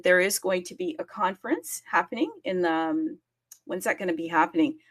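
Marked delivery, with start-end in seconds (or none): none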